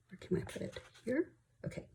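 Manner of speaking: whispered question to herself; murmured aside about where the cash goes. Real, not acted. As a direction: whisper it while looking around. Muttering while arranging cash.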